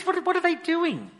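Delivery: ordinary talk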